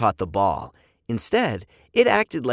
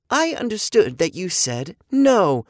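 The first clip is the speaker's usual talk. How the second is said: lightly stilted, higher robot; 7 semitones higher, 4 dB louder than usual. A narrator's reading. Robot misunderstanding the boy, lightly mechanical voice. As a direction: answer like a literal-minded machine.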